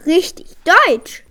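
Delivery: high, loud, slow astonishment; wondering exclamation; amazed. A narrator's reading. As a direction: marvel out loud, drawing it out. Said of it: "sagte eine Frau verwundert".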